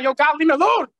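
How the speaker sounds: high, loud retold protest; mock exasperated yell. Student telling God to back off, mock exasperated yell.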